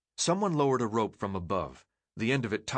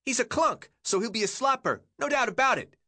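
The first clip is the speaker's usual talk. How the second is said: put-on much higher shrill kid voice; 13 semitones higher, 4 dB louder than usual. A narrator's reading. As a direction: pipe it up shrill and teasing. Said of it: "The kid giggled".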